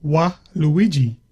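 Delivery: "wa Luigi" slowly, split after "wa"; syllable-by-syllable coaching. Shown as a demonstration. Host coaching contestant to pronounce it, slow split "wa Luigi". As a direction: model "wa", then "Luigi", as separate words. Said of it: "Now say wa".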